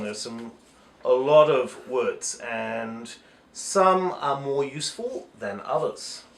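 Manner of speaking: normal talking voice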